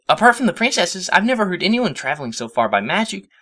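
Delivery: high, eager questioning; excited Twilight. A narrator's reading. Twilight questions her hero, high and eager. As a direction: starstruck.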